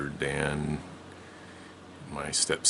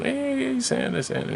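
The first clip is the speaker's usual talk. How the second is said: put-on excited kids; slower than usual. Kids calling Santa.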